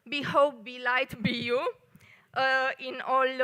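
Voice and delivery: usual speaking voice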